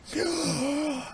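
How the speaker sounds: long, high-pitched gasp; figure's gasp. Acted. Game master plays the figure rising from the pod, high gasp. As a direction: draw in a high, soft gasp. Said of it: "she's just like".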